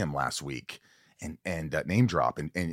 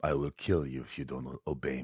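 quiet menacing threat; 4 semitones lower, 7 dB quieter than usual. Shown as a demonstration. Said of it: "Okay, say".

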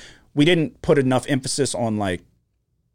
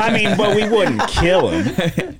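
laughing through the words, higher and slower; 9 semitones higher, 4 dB louder, slower than usual. Real, not acted. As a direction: laugh it out while joking. Laughing at his own joke.

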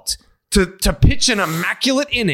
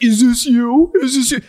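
higher-pitched pestering question; mock pestering crowd; 5 semitones higher, 4 dB louder than usual. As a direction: mimic everyone nagging at once. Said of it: "everyone was like".

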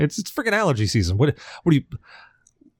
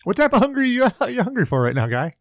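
mock doctor voice; 12 semitones higher, faster than usual.

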